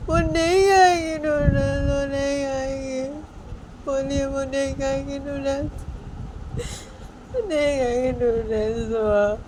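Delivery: high, slow, drawn-out acted bawling; tearful wailing. Bani refuses to stop crying to chhoti maa, long wail. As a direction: stretch every word into a crying wail. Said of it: "छोटियों को रोते हुए बोलती".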